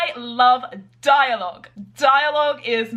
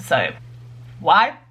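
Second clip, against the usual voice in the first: ordinary delivery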